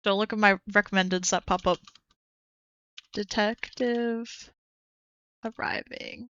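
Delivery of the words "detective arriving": slow, drawn-out whisper. Shown as a demonstration. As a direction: breathe the words out slowly. Reads video title twice.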